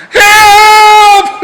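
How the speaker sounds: very high, loud cry of help; imitated scream for help. Bikie held underwater screaming for help, very loud and high. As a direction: yell help like a man being drowned. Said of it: "the bikie yelling out".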